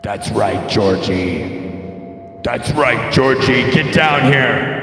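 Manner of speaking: loud, slow predatory clown calling down; menacing clown shout. Acted. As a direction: boom it out like a clown luring from a drain. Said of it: "that wasn't a voice changer".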